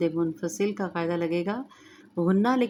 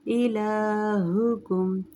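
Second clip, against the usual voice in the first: slow melodic tajweed chant; Quran recitation; 2 semitones higher, much slower than usual. Shown as a demonstration. Recites with rules.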